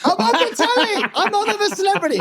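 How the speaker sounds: very high, gleeful I'm on the telly; high-pitched mock overexcited fan voice; excited fan. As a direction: squeak it like a thrilled intruder. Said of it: "guy with a GoPro".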